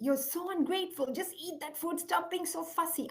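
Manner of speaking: high-pitched mock scolding, short of a yell; retold shouting. Mother losing it at her fussy five-year-old over dinner, mock shouting. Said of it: "I started shouting".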